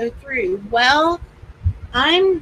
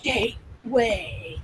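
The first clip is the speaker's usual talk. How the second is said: slow, lower and softer spooky echo; imitating the creepy voice; 4 semitones lower, 7 dB quieter, much slower than usual. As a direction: stretch it out softly like the eerie device. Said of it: "Did you hear that voice?".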